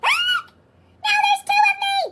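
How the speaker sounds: very high, fast squeaky shriek; squeaky cartoon voice. Larry shrieks at doubling.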